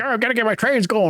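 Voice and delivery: high, fast whiny complaint. Retold or imitated. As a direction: squeak it out like a fussy boss. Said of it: "he's just yelling at Garber".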